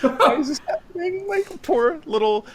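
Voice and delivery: very high, loud wailing cry of despair; hammed-up anguished wail; mock anguish. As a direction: wail to the heavens in hammed-up grief. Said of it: "and I'm like".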